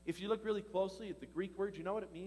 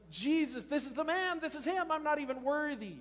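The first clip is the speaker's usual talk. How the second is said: proclaiming John; 7 semitones higher, 4 dB louder than usual. Acted. John the Baptist preaching.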